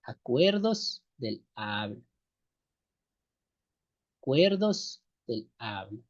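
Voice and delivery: slow dictation. Shown as a demonstration. Teacher dictating the definition to students, slow and clear. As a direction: spell it out slowly so students can write. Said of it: "son acuerdos del habla".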